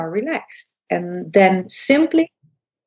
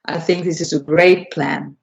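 lower, charismatic leader's voice; low Obama voice; 5 semitones lower than usual. Shown as a demonstration. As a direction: let the belly go and speak low with authority. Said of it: "I'll simply blubber my belly out".

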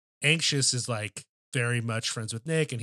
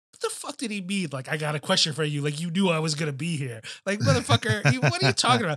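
incredulous rant; 4 semitones higher than usual. Retold or imitated. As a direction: replay your own baffled rant, growing more worked up. Retold baffled reaction, "I went.

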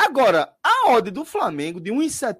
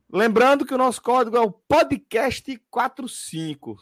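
upbeat sponsor plug, higher; ad read; 6 semitones higher, 3 dB louder than usual. Acted. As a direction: pitch the promo code brightly. Sponsor code reminder.

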